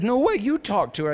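high, loud acted protest; mock adamant refusal. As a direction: dig in, hamming up the squabble. Acted refusal in marital argument.

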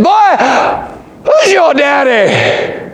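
high, slow, loud demand; loud preacher voice. Retold or imitated. Preacher calling the boy out before the congregation, loud and slow. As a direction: call him out so the whole church hears. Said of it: "He was talking so loud".

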